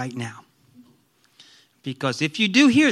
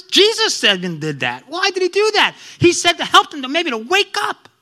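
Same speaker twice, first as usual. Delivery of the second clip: normal talking voice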